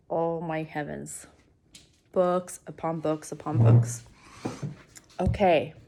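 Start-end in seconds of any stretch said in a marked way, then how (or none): none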